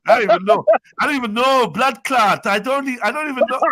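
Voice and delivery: American-Jamaican accent, played for laughs. Shown as a demonstration. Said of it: "to do the American Jamaican accent".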